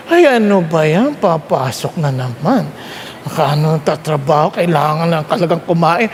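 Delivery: low, tired complaining groan; weary grumble. Pretend. Preacher mimics a tired employee dreading work, low and weary. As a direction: sigh it out like a worker dreading Monday.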